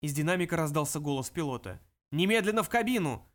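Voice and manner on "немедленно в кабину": panicked yell through the speaker; pilot over the radio yelling. Acted. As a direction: shout it over the intercom. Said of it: "потеряв самообладание, орал он".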